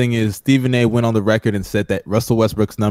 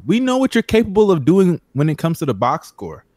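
heated; 6 semitones higher than usual. Real, not acted. Heated argument about Russ.

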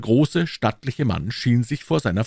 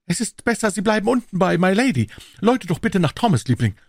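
high-pitched, urgent lord; drängender Lord; 13 semitones higher, 3 dB louder than usual. Acted. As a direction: give instructions in the lord's high voice. (hohe, drängende Lordstimme)

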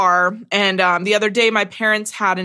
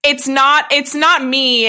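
raised, insistent denial; emphatic; 6 semitones higher, 4 dB louder, slower than usual. Real, not acted. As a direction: push back loudly and fast.

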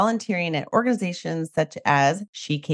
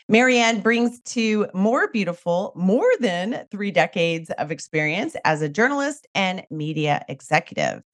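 usual speaking voice

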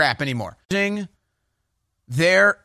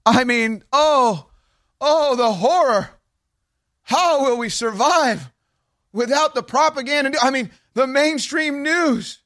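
high, slow, hammed-up fake dismay; sarcastic mock horror; 9 semitones higher, slower than usual. Host mocking the news collapse, hammed-up fake horror. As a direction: drip sarcasm as if clutching pearls.

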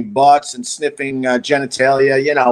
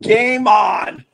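raised-pitch call to combat; higher, punchy challenge; mock battle cry; 6 semitones higher, much slower than usual. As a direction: act out squaring up for a fight. Readies to fight the charging dog.

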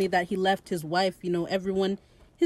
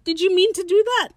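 high, louder, baffled question; imitating a bewildered victim; mock incredulous; 10 semitones higher, 5 dB louder than usual. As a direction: act out offended disbelief, pitched way up. Acts out stabbed man's disbelief.

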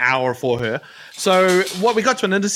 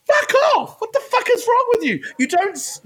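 high-pitched road-rage outburst; outraged yell. Retold or imitated. Driver yelling at tailgater behind him, outraged. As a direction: explode at the tailgater.

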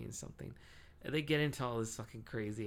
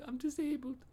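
scared. Retold or imitated. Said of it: "And they're like".

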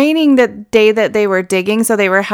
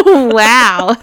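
normal talking voice

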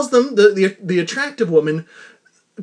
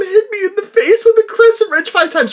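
laughing; 12 semitones higher, 3 dB louder than usual. Retold or imitated. Quoting the man, still laughing.

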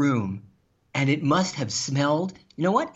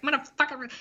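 high-pitched mock Boston rant; hammed-up Boston tough, pitched high; Boston guy ranting; 10 semitones higher, faster than usual. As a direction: imitate a Boston guy losing it. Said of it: "the Boston guy going".

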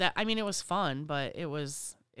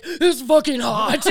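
loud, slow, high laughing grumble; laughing mock complaint; 8 semitones higher, 13 dB louder, much slower than usual. Server at the table, laughing loud complaint. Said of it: "you're like".

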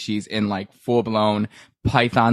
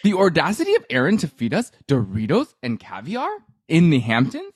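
imitating Ramona; 4 semitones higher than usual. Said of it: "Ramona would have been like".

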